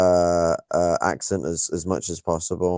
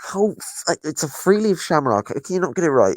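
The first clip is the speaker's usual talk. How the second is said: usual speaking voice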